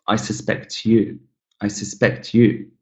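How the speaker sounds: "suspect" said sus-PECT in the sentence; second-syllable stress. Shown as a demonstration. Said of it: "stress falls on the second syllable".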